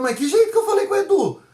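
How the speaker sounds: high-pitched, quick, baffled comeback; indignant protest. Retold or imitated. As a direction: protest as if wrongly accused, voice shooting up. Acts out own protest to wife.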